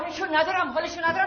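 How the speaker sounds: high-pitched echo of a complaint; mocking mimicry. Mother parrots her son's complaint, mocking. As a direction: throw the words back mockingly.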